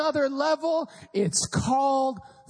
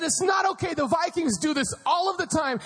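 dad yelling; 4 semitones higher, faster than usual. Retold or imitated. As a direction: rant like a fed-up football dad. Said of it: "Now he's yelling".

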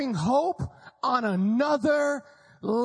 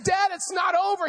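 urging dad; 7 semitones higher than usual. Retold or imitated. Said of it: "I said".

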